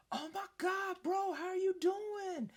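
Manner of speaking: high, loud mock reunion shout; gushing fake greeting. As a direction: greet a stranger like a long-lost pal, high and drawn out. Faking reunion with a stranger.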